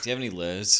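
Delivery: imitating a Bostonian saying "lures"; Boston accent, imitated. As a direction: put on Boston vowels for "lures". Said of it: "listening to someone from Boston".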